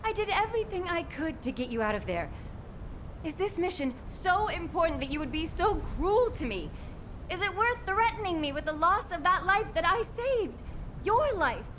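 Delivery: high-pitched, emotional outpouring; anguished reproach. Acted. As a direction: plead and accuse, deeply hurt. Kate's anguished quarrel with Freyna.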